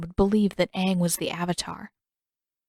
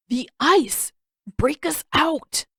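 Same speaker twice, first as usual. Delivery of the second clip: higher, slow, exasperated demand; Sokka yelling, stuck in ice; frustrated shout; 7 semitones higher, slower than usual. A narrator's reading. Sokka yells at his sister to break the ice, exasperated.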